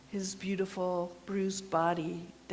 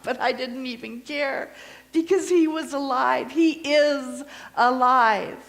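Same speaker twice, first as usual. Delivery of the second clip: triumphant proclamation; 6 semitones higher, 11 dB louder than usual. Acted. Mary declaring the resurrection, loud triumphant proclamation. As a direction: proclaim it to the rafters.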